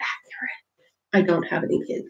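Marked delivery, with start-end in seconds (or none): 0.1-0.6 whispered. Real, not acted. Deadpan aside on meme.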